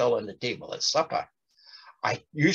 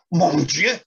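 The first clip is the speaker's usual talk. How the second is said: a sharp, louder cry of dismay; 4 semitones higher, 6 dB louder than usual. Acted. Yvonne cries out to herself in alarm. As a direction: blurt it out in sudden alarm. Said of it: "Yvonne cried".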